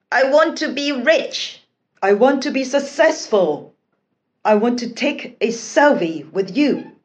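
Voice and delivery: high, loud eager wishing; exhilarated. A narrator's reading. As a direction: blurt out wishes with glee. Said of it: "The adventurers were exhilarated".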